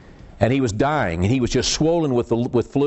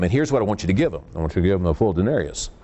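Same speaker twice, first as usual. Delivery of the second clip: a lightly lowered, quick voice for the master; a hint of the commanding landowner; landowner giving orders; 8 semitones lower, faster than usual. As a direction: hurry out the boss's instruction in a lower voice. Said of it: "the owner says".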